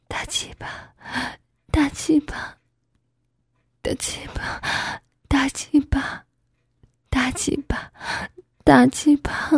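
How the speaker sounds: breathy near-whisper with long pauses; hushed, unhurried intimate murmur; slow, coaxing repetition. A narrator's reading. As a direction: draw each repeat out softly, as if into an ear. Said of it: "喊慢点儿".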